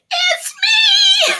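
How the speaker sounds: giggling at her own line; laughing catchphrase. Real, not acted. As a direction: crack up while saying it.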